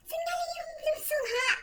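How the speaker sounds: very high, soft squeal; retold call. Acts out calling partner teacher. (napakataas at mahinang tili)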